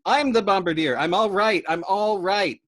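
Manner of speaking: loud, high insistent yell; shouting. Acted. Yossarian insists to Dobbs, shouting. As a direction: keep yelling to be heard. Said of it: "Yossarian cried back at him".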